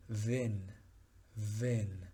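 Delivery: "Ven" as French vienne, oral vowel then a clear N; French vienne, two syllables. Shown as a demonstration. Teacher contrasts vin with vienne for students, clear final N. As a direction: split it, vi and a plain N-e, no nasal vowel. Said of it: "la pronunciación se transforma completamente".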